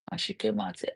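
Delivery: ordinary talk, nothing marked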